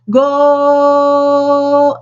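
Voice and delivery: high, sustained goal cry held very long; soccer announcer. Retold or imitated. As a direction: imitate an announcer stretching "Goal" on and on. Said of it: "the announcer says".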